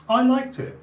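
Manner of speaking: loud high declaration; emphatic. Real, not acted. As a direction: proudly admit it out loud.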